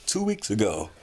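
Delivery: word stretched by a yawn. Real, not acted. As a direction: say it through a yawn. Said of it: "I'm yawning".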